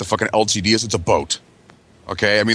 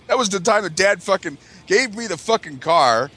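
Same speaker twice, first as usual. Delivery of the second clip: ordinary delivery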